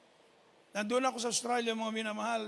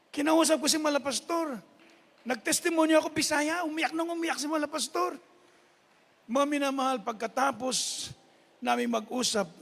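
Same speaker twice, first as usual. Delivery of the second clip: raised, louder preacher's voice; preaching at full force; 5 semitones higher, 6 dB louder than usual. Acted. Preacher telling the congregation their life is with God, in full force. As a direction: proclaim it to the congregation.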